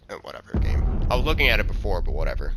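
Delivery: ordinary talk, nothing marked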